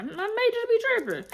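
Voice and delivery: playful high-pitched self-mocking; mock high squeal. Friend admits she overreacted.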